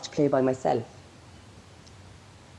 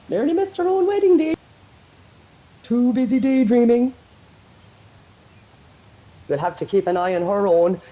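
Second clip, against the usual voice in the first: teasing relatives; 3 semitones higher, 4 dB louder than usual. Acted. Family chiding Maggie on her wedding day, teasing. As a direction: tease her like amused, scolding family. Acts out the laughing wedding party.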